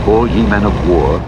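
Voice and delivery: ordinary delivery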